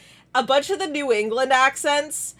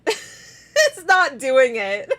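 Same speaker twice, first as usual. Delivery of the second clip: slow words through laughter; real amused laugh in the voice; 7 semitones higher, 3 dB louder, much slower than usual. Real, not acted. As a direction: giggle helplessly while getting it out. Laughs at own muddled brain.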